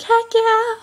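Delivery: high, loud cheer; excited cheer. Retold or imitated. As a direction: cheer it triumphantly. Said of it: "I was like".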